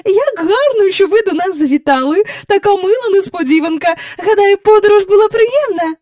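high, loud, hurried pleasantries; gushing hostess. A narrator's reading. Hostess receiving an unexpected guest, gushing. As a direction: fuss politely over the guest.

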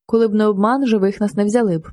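ordinary delivery, nothing marked

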